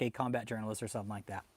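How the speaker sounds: ordinary talk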